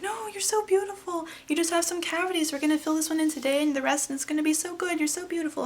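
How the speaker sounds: high, cooing comfort; imitated reassuring nurse. Nurse comforts the crying patient, high and cooing.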